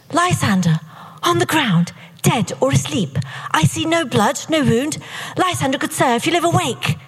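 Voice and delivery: theatrical verse delivery, high with falling phrases; stage Helena. Acted. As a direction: perform it as an earnest stage heroine, pitched high. Acting Helena's line in rehearsal.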